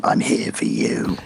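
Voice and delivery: unvoiced, slow demonic whisper. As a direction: breathe it out like a demon, slowly.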